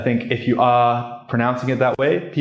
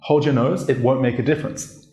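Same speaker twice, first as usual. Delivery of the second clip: ordinary delivery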